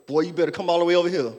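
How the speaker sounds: ordinary talk, nothing marked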